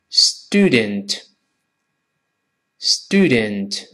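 student slowly, both syllables full; slow reading. Shown as a demonstration. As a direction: draw out "stoo-dent" for learners. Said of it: "先慢慢读". (慢读 student)

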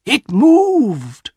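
high, loud startled cry; frightened cry. Acted. Old man crying out to his family in fright. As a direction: cry out as the paw twitches in your hand.

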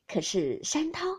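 plain reading voice

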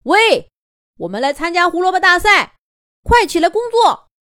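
贴着耳朵高声大喊; 冲着耳朵吼醒他. Acted. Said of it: "紧贴着吼了一声". (贴着耳朵吼)

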